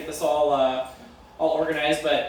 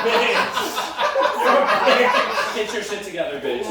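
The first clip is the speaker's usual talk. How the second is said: punchy roast jab; 4 semitones higher, 4 dB louder than usual. Real, not acted.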